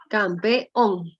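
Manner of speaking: "Campeón" broken slowly into cam, pe, ón; syllable by syllable. Shown as a demonstration. Teacher showing students the last syllable, "Campeón" split syllable by syllable. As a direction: sound out "Campeón" in separate syllables, landing on the final ón. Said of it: "Ahí, última sílaba".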